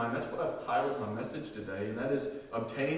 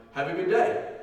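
higher, louder everyday send-off; casual farewell; 6 semitones higher, 7 dB louder than usual. Acted. Saying goodbye to somebody in our day, casual farewell. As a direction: call out a friendly goodbye. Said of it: "like when you say to somebody".

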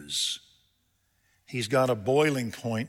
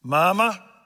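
higher, louder call, drawn out; calling for Mama; 7 semitones higher, 8 dB louder than usual. Retold or imitated. Father calling the mother out to deal with the boy, raised call. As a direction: summon the mother with one word. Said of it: "I heard him say the word".

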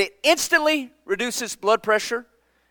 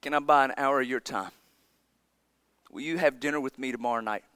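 put-on child's quiet plea; 8 semitones lower, 9 dB quieter than usual. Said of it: "and said".

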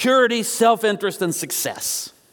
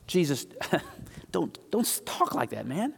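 soft, low aside with a slight laugh; acted-out private telling-off, kept down; hushed chiding; 3 semitones lower, 9 dB quieter than usual. Peter taking Jesus aside, hushed chiding.

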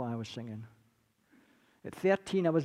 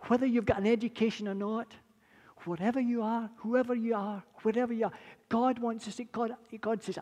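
normal talking voice

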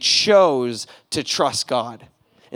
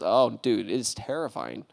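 ordinary delivery

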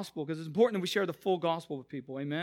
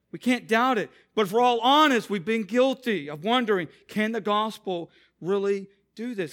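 louder, higher preaching build; 3 semitones higher, 7 dB louder than usual. Acted. The pastor urging the church, louder and preaching hard. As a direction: drive it home to the congregation.

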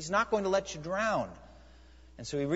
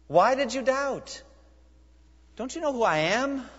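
pleading rebuke; 9 semitones higher, 4 dB louder than usual. Acted. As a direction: ask it as a wounded friend. Preacher voicing Jesus rebuking Peter.

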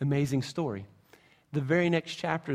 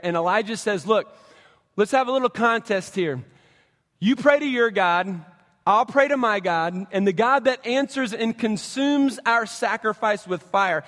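raised, louder storytelling push; preaching storytelling; 4 semitones higher, 4 dB louder than usual. Acted. Pastor retelling Elijah's challenge, raised and building. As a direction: build the showdown for the crowd.

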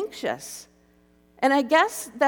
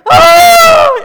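very loud, high drawn-out wail; hammed-up howl of despair; mock sobbing wail; 21 dB louder than usual. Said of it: "sobbing, sobbing".

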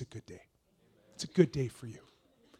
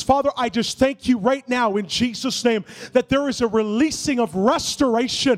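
loud, higher prophetic prayer over music; fervent prayer; 5 semitones higher, 8 dB louder than usual. Real, not acted. Pastor praying over the standing congregation, loud and fervent.

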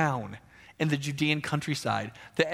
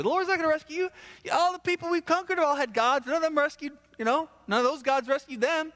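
high, fast, sneering envoy taunting the city; mocking taunt; 10 semitones higher than usual. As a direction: jeer at them, faster and higher. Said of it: "sends his guy up to taunt".

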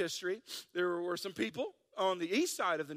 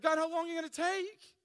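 higher, louder whiny complaint; whining; 8 semitones higher, 5 dB louder than usual. Acted. Pastor voicing our everyday prayer to God, whining. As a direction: whine at God impatiently. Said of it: "That sounds whining to me".

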